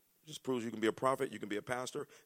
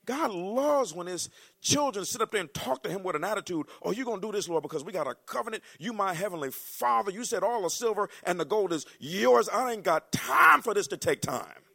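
higher, sassy demanding prayer; bold, impatient prayer; praying with attitude; 11 semitones higher, 10 dB louder than usual. Retold or imitated. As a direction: talk to God like you're owed. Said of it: "talk to God like that".

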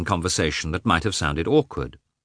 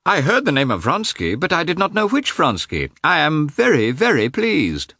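eager greeting; 9 semitones higher, 3 dB louder than usual. A narrator's reading. Old friend greets Vronsky eagerly.

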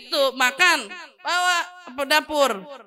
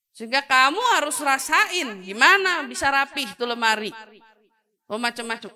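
ordinary talk, nothing marked

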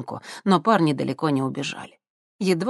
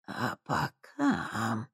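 put-on soft, slow menacing old woman; sinister crone; 3 semitones lower, 9 dB quieter, much slower than usual. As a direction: purr it like a sinister old witch.

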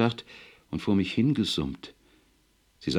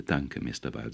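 softly, a touch choked up with gratitude; quiet, understated emotion in the thanks; gently moved, a little softer; 6 semitones lower, 5 dB quieter than usual. Acted. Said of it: "sagte er bewegt".

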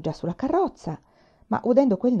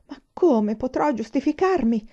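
higher, whining fearful question; bleating; 6 semitones higher, 4 dB louder than usual. A narrator's reading. Jailer fretting about his excuse, bleating. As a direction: bleat it helplessly. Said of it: "belò".

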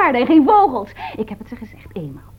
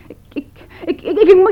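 laughing stammer; 6 semitones higher, 6 dB louder than usual. Acted. Flustered maid stammers, laughing.

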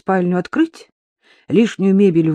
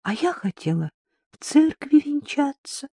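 bitter sigh; 9 semitones higher, 4 dB quieter than usual. A narrator's reading. Bride who wanted a church wedding, sighing bitterly. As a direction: sigh it out wistfully, drawing out the words. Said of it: "горько вздохнула Катенька".

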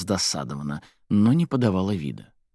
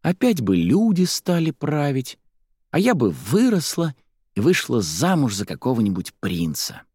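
put-on high-pitched girlish daydreaming; high, wistful kid voice; dreamy child; 6 semitones higher than usual. A narrator's reading. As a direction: wish aloud like a little girl. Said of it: "мечтательно вздохнула Марца".

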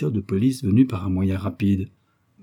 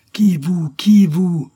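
high, loud indignant demand; yelled; 9 semitones higher, 9 dB louder, much slower than usual. A narrator's reading. Commissioner to the old man in doorway, yelling. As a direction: bellow the question at him. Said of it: "hurla le commissaire".

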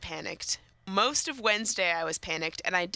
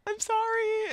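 sheepish apology; slower than usual. Retold or imitated. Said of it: "It's like".

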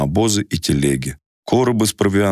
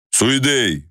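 rough calling voice; 8 semitones higher than usual. A narrator's reading. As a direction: call out gruffly, higher pitch. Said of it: "окликнул воин грубым голосом".

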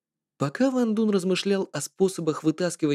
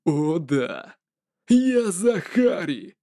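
evil laugh; much slower than usual. Acted. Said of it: "злобно засмеялся и сказал".

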